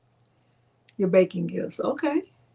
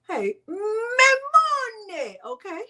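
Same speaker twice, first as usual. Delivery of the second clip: close to tears; 12 semitones higher, much slower than usual. Real, not acted. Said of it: "you're not gonna cry".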